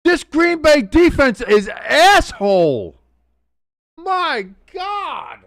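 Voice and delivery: high, drawn-out disbelief; loud, slow cry falling away on the last words; stunned outburst. Real, not acted. Fan reacting to Green Bay's defense, stunned outburst.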